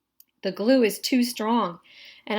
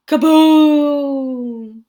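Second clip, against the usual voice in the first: explosion sound; 3 semitones higher, 9 dB louder than usual. A narrator's reading. Tow truck explodes.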